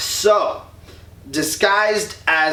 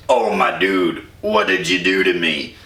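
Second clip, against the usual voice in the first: much lower, sickly groan; low groaning Cronus; 9 semitones lower than usual. Retold or imitated. Poisoned Cronus groaning at his son, low mock voice. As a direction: drop the voice low like a poisoned old god.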